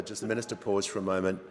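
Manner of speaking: ordinary delivery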